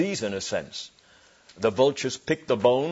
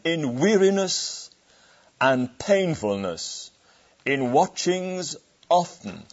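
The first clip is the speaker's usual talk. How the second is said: slow, drawn-out reading with a weight on each word; solemn scripture reading; 4 semitones higher, slower than usual. Acted. Preacher reading Paul's hardships aloud, slow and solemn. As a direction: let each hardship sink in slowly.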